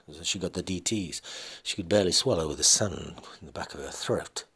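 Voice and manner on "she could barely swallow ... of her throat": ordinary delivery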